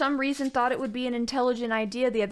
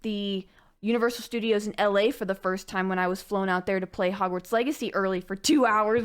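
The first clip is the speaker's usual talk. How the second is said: usual speaking voice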